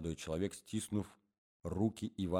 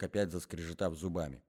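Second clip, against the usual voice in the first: ordinary delivery, nothing marked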